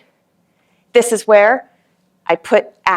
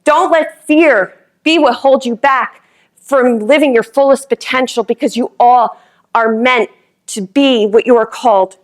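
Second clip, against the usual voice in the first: raised, rousing rally voice; booming motivational speech; 3 semitones higher than usual. Acted. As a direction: fire up the whole room. Motivational speech at full force.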